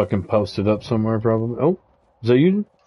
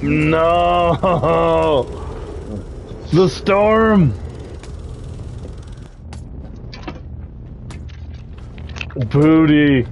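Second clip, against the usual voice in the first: excited; 6 semitones higher, much slower than usual. Real, not acted. Tense fight, calling out.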